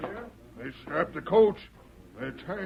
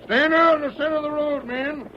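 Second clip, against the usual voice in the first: fast, high, loud command to the men; shouted order; 6 semitones higher, 4 dB louder than usual. Acted. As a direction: bark it out across the road. Sheriff shouting orders to posse.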